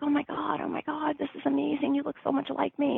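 mock him swooning over the likeness; imitating his gushing.

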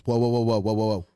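retold loud stop. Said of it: "and Lab goes".

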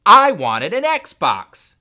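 slow, very loud, high tantrum; bratty full-volume yell; shouting back. A narrator's reading. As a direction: bellow it like a furious child. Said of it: "Henry shouted back".

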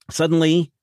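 usual speaking voice

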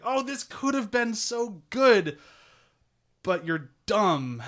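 drawn-out, high mock sigh over a lost chance; mock lament. Narrator groaning at the doomed couple, mock lament. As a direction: lament it slowly like a wistful fan.

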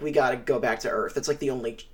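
low, quiet, solemn resignation; mock grave, said softly and low. Crew admitting defeat to each other, solemn and quiet. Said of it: "they essentially are all solemnly like".

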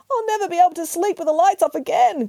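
high-pitched little bear wail; Charles crying out. A narrator's reading. Bear cub upset by the intruder, high cry. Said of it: "cried Charles".

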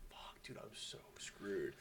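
slow, hushed whisper; dread-filled whisper. Retold or imitated. Listening to mom on the phone, doomed slow whisper. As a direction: whisper it slowly, sunk in dread.

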